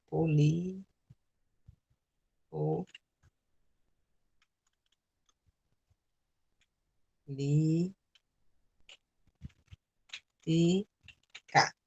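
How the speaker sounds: soft, separated syllables with long pauses. Shown as a demonstration. Teacher splitting the word on the board, syllable by syllable. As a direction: murmur each syllable on its own. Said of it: "Eu separo as sílabas".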